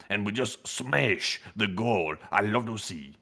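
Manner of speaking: soft, low-key bad Zelensky voice; a rough, lightly done take on a president thanking donors; Zelensky impression. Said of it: "No, that was supposed to be Zelensky".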